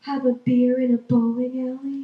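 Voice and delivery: slow, coaxing come-hither voice; seductive hometown. Pretend. Hometown sweet-talking a broke ex-resident, slow and coaxing. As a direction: lure her home, drawn out and sweet.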